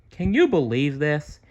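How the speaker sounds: ordinary delivery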